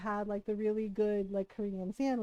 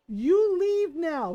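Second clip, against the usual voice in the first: stern order; 5 semitones higher, 7 dB louder, much slower than usual. Retold or imitated. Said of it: "stares at him and goes".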